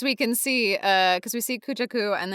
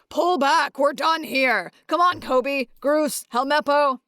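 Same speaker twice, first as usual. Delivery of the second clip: raised, higher commander's call; mock battlefield yell, moderately loud; acted yell; 7 semitones higher, 4 dB louder than usual. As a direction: call out orders to your crew. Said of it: "so Garp yells".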